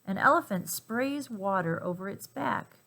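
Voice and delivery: usual speaking voice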